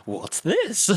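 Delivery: gasp, then high-pitched mock astonishment; stagey fake surprise. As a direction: overact being taken by surprise. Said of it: "like, acting surprised".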